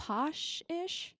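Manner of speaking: high, slow posh English accent; drawn-out posh English vowel, questioning; mock posh accent. Shown as a demonstration. Host labelling co-host's accent, mock posh accent.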